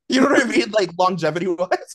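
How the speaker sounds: high, loud laughing words. Real, not acted. Laughing at own joke.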